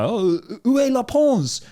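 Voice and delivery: où est la Pons with the S sounded, high, slow and loud; clueless tourist asking où est la Pons, S said; wrong French Pons. Retold or imitated. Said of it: "but it's pronounced Pont".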